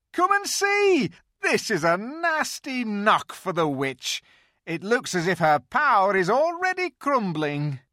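high, loud, gleeful beaver voice; a beaver bursting with good news; delighted beaver. A narrator's reading. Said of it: "almost dancing with delight".

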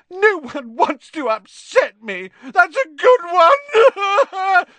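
very high, loud, drawn-out wail; full-blown theatrical tearful howl. A narrator's reading. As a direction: howl it slowly, breaking into sobs. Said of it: "howled Myrtle".

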